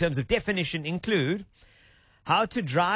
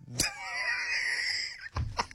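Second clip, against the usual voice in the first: breathy high laugh; 6 dB quieter than usual. Real, not acted. Laughs at wine comment.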